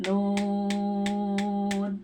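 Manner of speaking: "Nun" held very long on one level note; six-count long Nun. Shown as a demonstration. Teacher demonstrating six harkat to students, "Nun" drawn out evenly. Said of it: "enam harkat misalnya di sini".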